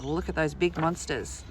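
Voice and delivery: usual speaking voice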